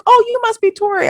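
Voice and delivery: lightly voicing the daughter's polite surprise; high mock daughter voice.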